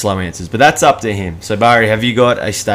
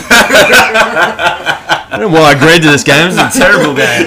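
laughing; 7 semitones higher, 8 dB louder than usual. Real, not acted. Laughing complaint.

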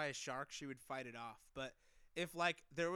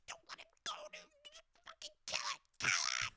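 high, whispered mock plea; mock panic; 3 dB quieter than usual. Imitating panicked partner.

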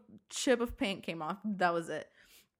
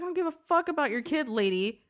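fast, higher-pitched telling-off; retold yelling; 6 semitones higher, 4 dB louder than usual. Driver cutting off the lady's excuse, yelling. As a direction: rush out an irritated rebuke. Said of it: "after I yelled at her".

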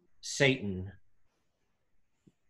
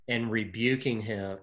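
ordinary delivery

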